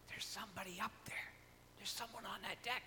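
hushed, fast ladies' whisper across the water; whispered gossip. Retold or imitated. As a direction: murmur it as if nobody can hear. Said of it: "You could hear people whispering".

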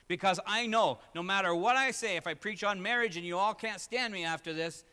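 loud, high, emphatic preaching; full-force preaching. Acted. Pastor telling the congregation his wife has his back, preaching loud.

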